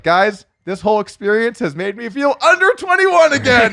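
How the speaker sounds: high, loud and excited; teen gloating to the guards after getting away; gloating joke. Retold or imitated. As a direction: crow it at the guards, grinning.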